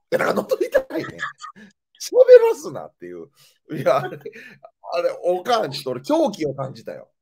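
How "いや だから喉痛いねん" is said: exasperated repeat. Retold or imitated. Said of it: "おかん、だから喉痛いねん。".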